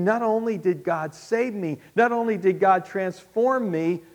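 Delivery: rising, loud, amazed voicing of Paul; excited imitation of an awestruck Paul; Paul's amazed thoughts. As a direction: build wonder line by line. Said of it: "He's thinking".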